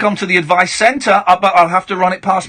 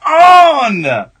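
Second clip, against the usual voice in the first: calling out; 6 semitones higher, 6 dB louder than usual. Real, not acted. Host urging caller back.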